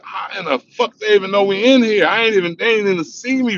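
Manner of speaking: high astonished how do they know; freaking out in disbelief; panicked disbelief. Retold or imitated. Hiding inside, stunned the cops know, high disbelief.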